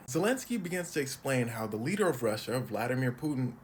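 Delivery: ordinary delivery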